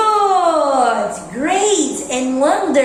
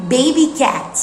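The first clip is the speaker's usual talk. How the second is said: usual speaking voice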